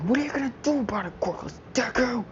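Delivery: fast, high sneering taunt; mocking bully taunt.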